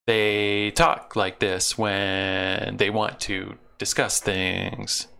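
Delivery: very slow, sing-song robot cadence; sing-songy Cyberman voice. Shown as a demonstration. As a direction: drag it out like a Cyberman. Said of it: "a little bit of a sing-songy voice".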